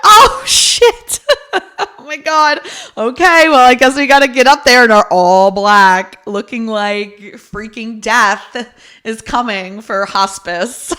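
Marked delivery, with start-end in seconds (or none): 0.0-1.2 high, loud laughing realization. Retold or imitated. 1.2-2.0 loud high laugh; high burst of laughter. Real, not acted. Laughing at her own class mistake, high real burst of laughter. After the realization. 3.0-10.9 laughing resignation. Retold or imitated.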